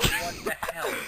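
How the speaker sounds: high airy gasped disbelief; shocked what the hell. Retold or imitated. Mac lands and gasps at the chaos. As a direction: gasp it out in shock.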